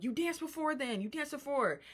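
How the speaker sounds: high-pitched imitated nagging; mock accusing. Housemate arguing back over dancing, high and accusing. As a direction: pitch it way up, insisting. Retold back-and-forth argument.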